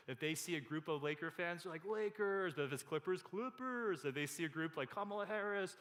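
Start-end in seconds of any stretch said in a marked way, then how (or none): 1.8-2.5 higher-pitched mock fan cheer; pandering fan cheer. Mimics philosophers pandering to fans. 3.2-4.1 high mock cheer for the team; pandering fan cheer. Mimics switching to rival fans.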